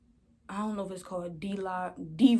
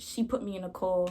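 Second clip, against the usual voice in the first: ordinary talk, nothing marked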